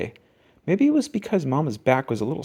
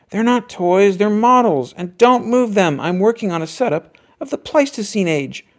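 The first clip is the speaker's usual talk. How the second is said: louder, indignant big brother voice; Brother protesting; 6 semitones higher, 5 dB louder than usual. A narrator's reading. Brother defending his models to Sister, protesting. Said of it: "Brother protested".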